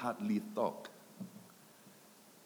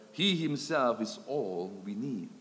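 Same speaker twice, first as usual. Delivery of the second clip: ordinary talk, nothing marked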